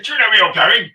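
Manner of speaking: loud, high, accusing demand; angry passenger. Retold or imitated. Maxwell barking at his chauffeur, loud and high. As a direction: bark at the driver from the back seat.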